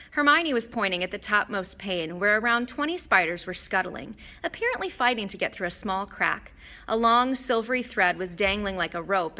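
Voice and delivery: ordinary delivery, nothing marked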